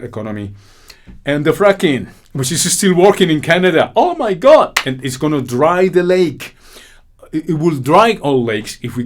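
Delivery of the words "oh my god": outraged exclamation. Real, not acted. Outrage at the water figures.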